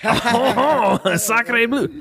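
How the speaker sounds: high French exclamation with a laugh; startled Frenchman meeting a star; French accent. Pretend. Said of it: "a Frenchman just met Gene Wilder".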